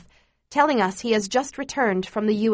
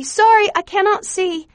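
high, loud call over a crowd; calling out loudly; 11 semitones higher, 7 dB louder than usual. A narrator's reading. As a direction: call out to get through, voice raised high. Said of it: "calling out loudly".

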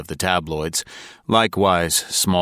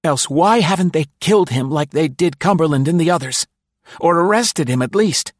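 put-on high, tense voice of a paranoid old spy; nervous conspirator pitched high; Densham's voice; 12 semitones higher, 7 dB louder than usual. A narrator's reading. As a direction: voice the frightened man up high.

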